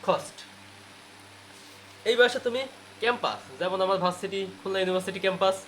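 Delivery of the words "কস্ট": কস্ট with a puff on K, "khost"; aspirated K. Shown as a demonstration. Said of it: "তাহলে ওটা বলতে হবে হচ্ছে". (কস্ট, খ দিয়ে খস্ট)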